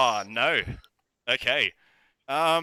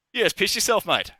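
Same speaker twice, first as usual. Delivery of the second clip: high, louder mocking jibe; jeering tease; 9 semitones higher, 5 dB louder than usual. Guards to their mate who wet himself, jeering. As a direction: rib a mate in a raised pitch.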